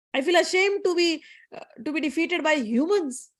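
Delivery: high-pitched, wounded disbelief; storyteller voicing an upset, incredulous son; acted ashamed protest. Defeated son protesting to his mother.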